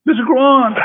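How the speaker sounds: high mocking line. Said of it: "stop mocking".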